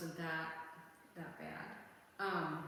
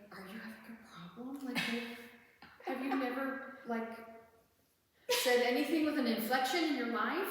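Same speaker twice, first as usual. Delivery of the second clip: exasperated; 7 semitones higher, 7 dB louder than usual. Retold or imitated. Mocking the flat-voiced cashier.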